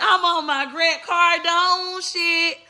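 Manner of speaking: stretched high bragging line, drawn out slowly; high slow boast. Acted. Boasting to her viewers in a high, slow drawl. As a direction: sing it high and slow like a hype mantra.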